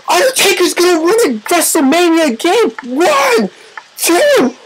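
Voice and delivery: high-pitched commentator's countdown; rising excitement into the count; excited pinfall call. Real, not acted. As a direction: call the near-win, voice climbing high.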